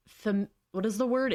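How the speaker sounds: ordinary delivery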